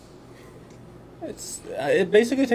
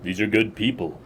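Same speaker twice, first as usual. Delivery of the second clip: lightly voiced tired captain, low and halting; understated weariness, lower and softer; weary old captain; 5 semitones lower, 11 dB quieter than usual. Captain confiding doubts to a subordinate, weary and low.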